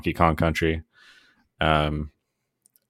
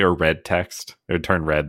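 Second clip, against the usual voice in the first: ordinary talk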